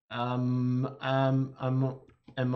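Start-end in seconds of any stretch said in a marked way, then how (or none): none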